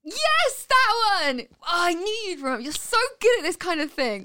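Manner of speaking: high, loud burst of delight; thrilled, high-pitched cheer; excited relief. Real, not acted. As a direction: exclaim it as the forgotten name lands. Partner finds the forgotten name.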